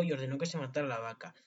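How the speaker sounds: ordinary delivery, nothing marked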